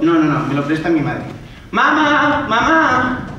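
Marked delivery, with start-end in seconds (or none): 1.7-3.3 llamando a mamá. Acted. Llama a su madre.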